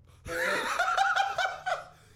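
high loud cackle; laughing out loud, sky high; shrieking laugh. Real, not acted. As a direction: burst out in a real shriek of laughter. Howling at the story.

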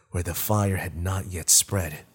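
ordinary delivery, nothing marked

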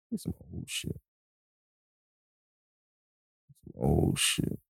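whispered low mutter to himself. Real, not acted. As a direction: mumble it under your breath while choosing. Muttering while picking songs.

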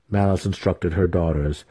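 ordinary delivery, nothing marked

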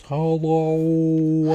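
stretched-out Dory whale hello. Acted. Showing the kid how Dory speaks it, whale voice. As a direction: draw the greeting out like whale talk. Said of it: "She goes".

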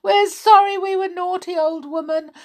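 put-on high pleading kids; children's high plea. Children begging the old woman's pardon, high.

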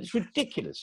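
high-pitched mock indignation; Richard Wilson. Friend quotes the actor's complaint, mock exasperation. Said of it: "he said".

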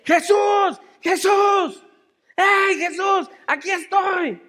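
high, loud cries for attention; desperate shout. Acted. Blind Bartimeo calling out to Jesús, desperate shout. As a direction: yell out as a desperate blind man. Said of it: "¿Cómo grita un ciego desesperado".

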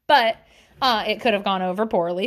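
usual speaking voice

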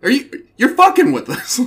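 very high, loud mock outrage; mock angry father. Father to daughter's boyfriend, hammed-up loud outrage. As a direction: blow up like the fed-up dad.

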